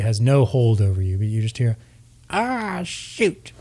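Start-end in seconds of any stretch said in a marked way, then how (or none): 2.3-3.5 put-on high, soft drawn-out old-lady voice; faint high echo of old ladies. Game master voices old ladies' echo, high and soft. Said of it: "from these old ladies".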